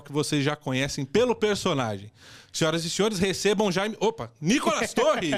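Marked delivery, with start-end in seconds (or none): 2.5-5.3 big showman guest announcement; booming stage introduction. Acted.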